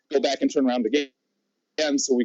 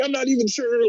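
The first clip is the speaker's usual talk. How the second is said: higher, put-on stranded victim voice; selling the stranded act; 9 semitones higher than usual. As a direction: play the abandoned friend for sympathy. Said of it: "he's just really selling it to her".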